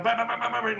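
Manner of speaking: high mock camera clicks, rapid-fire; a burst of high "bang" shutter noises; imitated camera shutters. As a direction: mimic cameras going off. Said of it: "like this".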